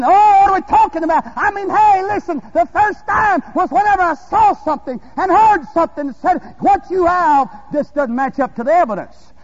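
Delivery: loud, high, fervent preaching cry; full-force preaching. Real, not acted. Preacher urging the congregation to draw from their own well, full-force preaching.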